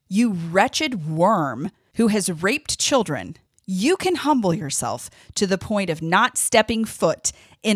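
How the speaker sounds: slow, hammered rebuke; furious rebuke. Real, not acted. Podcaster scolding a repentant abuser pastor, slow furious rebuke. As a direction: spit it slowly at the abuser. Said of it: "I am furious".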